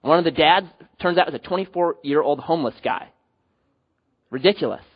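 ordinary talk, nothing marked

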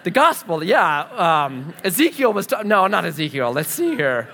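flustered, stumbling search for words; loud, scrambling hesitation; unprepared. Retold or imitated. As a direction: act out fumbling with fillers and false starts. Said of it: "I wasn't actually prepared for that".

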